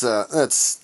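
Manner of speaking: normal talking voice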